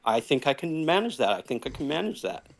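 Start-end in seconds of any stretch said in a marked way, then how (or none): 1.3-2.5 softer, trailing-off repeat; quiet repeat. Shown as a demonstration. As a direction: mumble it again, unsure. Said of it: "It's extra scary when I say".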